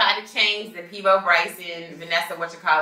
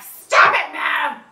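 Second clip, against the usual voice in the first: raised mock yell at a toddler; retold yell; 8 semitones higher, 8 dB louder, much slower than usual. Mom retelling yelling at her toddler son, loud and frustrated. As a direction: snap it out, frustrated and louder. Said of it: "I had yelled at him".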